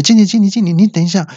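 high strained calls of manager, held in; straining call. Acted. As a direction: call out while pushing on the toilet. Calling boss while on toilet. (憋着劲高声喊经理)

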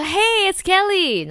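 high, loud cheery "hey it's Kelly"; bright phone greeting. Retold or imitated. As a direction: sing out the hello like a chirpy caller. Phoning coworker in acted voice.

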